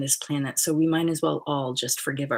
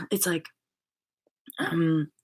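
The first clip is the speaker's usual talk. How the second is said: ordinary talk, nothing marked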